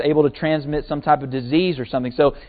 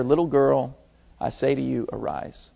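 tender command; 4 semitones lower, 7 dB quieter than usual. Retold or imitated. Jesus raising the girl gently.

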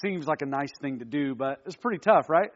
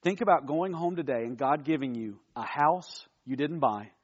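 ordinary talk, nothing marked

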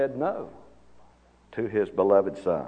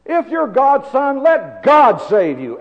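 loud, high, jeering shouts; ranting and raving; 13 semitones higher, 8 dB louder than usual. Retold or imitated. Preacher voices the crowd below the cross, loud ranting. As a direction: hurl it up like a raving mob. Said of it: "They're ranting and raving".

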